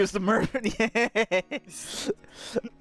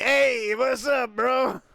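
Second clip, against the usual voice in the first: bro voice; 6 semitones higher, slower than usual. Retold or imitated. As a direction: hail them like a gym buddy. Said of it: "Or he could actually be like".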